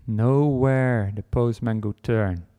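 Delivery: ordinary delivery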